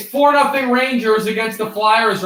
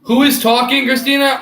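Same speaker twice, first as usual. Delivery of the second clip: calling out; 8 semitones higher, 5 dB louder than usual. Real, not acted. Calling out to someone.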